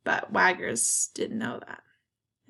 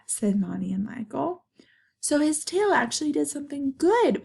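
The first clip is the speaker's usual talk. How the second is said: usual speaking voice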